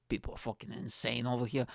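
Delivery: ordinary delivery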